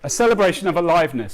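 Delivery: pushing through tears to finish the line; tearful. Real, not acted. Speaker to his audience, tearfully pushing through his line. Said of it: "So I knew I was gonna cry".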